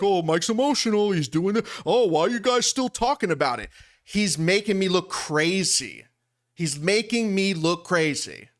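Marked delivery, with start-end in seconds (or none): 0.0-3.7 mocking imitation. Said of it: "I'm fine with people like".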